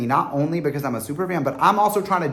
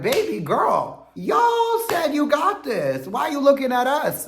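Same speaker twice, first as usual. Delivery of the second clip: higher, slow, drawn-out sass; sassy retort; 13 semitones higher than usual. Retold or imitated. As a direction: tease them with exaggerated sass. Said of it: "and I'm like".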